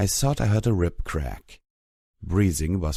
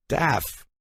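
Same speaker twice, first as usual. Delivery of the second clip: high sharp call across; 9 semitones higher than usual. A narrator's reading. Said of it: "she called back".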